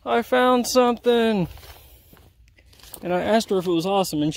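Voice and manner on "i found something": calling out. Retold or imitated. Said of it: "Brandy yells from across the field".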